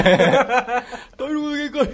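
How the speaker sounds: loud, high, drawn-out celebrity impression; hammed-up, booming mimicry; singer impression. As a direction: blurt it big and stretched for laughs. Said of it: "[name]のモノマネ、".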